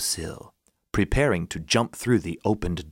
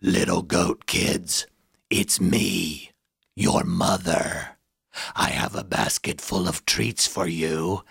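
low, slow wolf pretending to be mother; big bad wolf faking mother, slow; 4 semitones lower, much slower than usual. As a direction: growl it slyly like a wolf in disguise. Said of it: "A voice on the other side".

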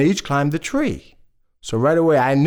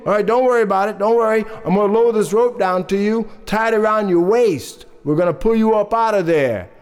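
raised, reassuring rescuer's voice; fire chief calling; 5 semitones higher than usual. Acted. Fire chief reassures the man in the well, calling down. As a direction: call down higher, taking charge. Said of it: "the fire chief says".

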